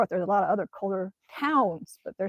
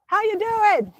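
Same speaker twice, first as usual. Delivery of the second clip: squeaky high-pitched greeting, louder; playful high-voiced question; high silly voice; 12 semitones higher, 6 dB louder, slower than usual. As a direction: redo the greeting in a high cartoon voice. Corrected greeting in same put-on voice.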